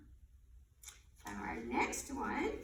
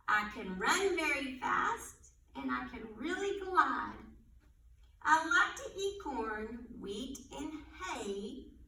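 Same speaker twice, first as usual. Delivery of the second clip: put-on riddle read aloud for little kids; reading to children; 3 semitones lower, 4 dB louder than usual. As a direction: read it like storytime. Reads animal riddles to children.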